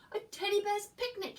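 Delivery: high, loud delighted bellow. Acted. Bear bellowing with joy over the picnic. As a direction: bellow it with glee. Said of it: "he bellowed".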